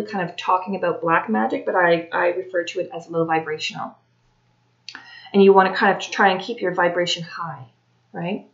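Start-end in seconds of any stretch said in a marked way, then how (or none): none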